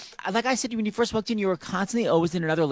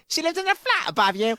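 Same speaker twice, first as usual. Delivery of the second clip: higher mock British lilt; mock British accent; 9 semitones higher than usual. Host repeats the guest's flat line in mock British accent. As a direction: tease it in a raised British accent. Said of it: "worst accent ever".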